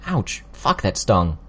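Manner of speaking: sharp yelp of pain, then a wince. Acted. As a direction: caught by a sudden sting. Nerve pain during healing.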